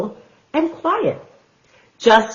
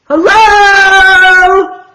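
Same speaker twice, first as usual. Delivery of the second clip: high, loud greeting; shouted hello; 13 semitones higher, 9 dB louder than usual. Acted. Tortoise shouts hello to Hank, high and loud. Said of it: "shouted a tortoise".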